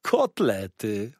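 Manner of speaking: drawn-out waiter's exclamation; slow, greedy delight; relishing exclamation. A narrator's reading. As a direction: savour the word slowly and with force. Waiter exclaims over the food.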